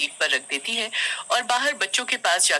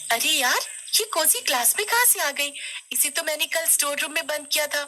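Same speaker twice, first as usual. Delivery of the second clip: put-on high-pitched, astonished child; puzzled young bully; baffled schoolgirl; 8 semitones higher than usual. A narrator's reading. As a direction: react in disbelief to the classmate's return. Plays Neha startled by Kosi.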